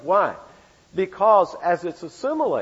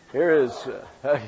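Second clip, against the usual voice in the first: normal talking voice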